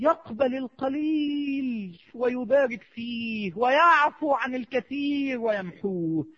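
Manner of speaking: very slow, stretched cadences; drawn-out praise. Real, not acted. Preacher extolling God's forgiveness to worshippers, slow drawn-out praise. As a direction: draw each phrase out in praise.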